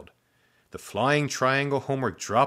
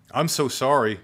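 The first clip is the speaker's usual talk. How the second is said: higher, louder apology; apologetic; 5 semitones higher, 6 dB louder than usual. A narrator's reading. New pupil apologises for handshake, apologetic. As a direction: apologise earnestly, voice lifted.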